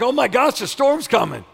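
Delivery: loud, high, thrilled exclamation; acted eager anticipation; excited welcome. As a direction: cry it out as wonderful news. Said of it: "it's like".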